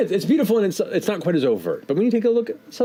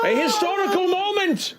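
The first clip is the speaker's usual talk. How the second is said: orcish barkeep announcing. Acted. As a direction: proclaim it to the whole bar like a herald. Barkeep finishing his grand announcement.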